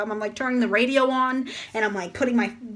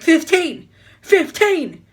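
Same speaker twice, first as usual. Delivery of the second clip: loud barked correction, higher pitched; imitated yelling; 4 semitones higher, 7 dB louder, slower than usual. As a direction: mimic an examiner yelling the limit. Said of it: "He keeps yelling it".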